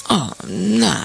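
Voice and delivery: exclaiming. A narrator's reading. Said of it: "exclamaron los parientes".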